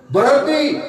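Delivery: high sudden call of Berhenti; shouted stop. Retold or imitated. A man calls down to the gravediggers, shouting high. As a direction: yell Berhenti from above the grave. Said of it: "dia teriak dari atas".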